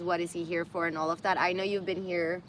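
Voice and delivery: usual speaking voice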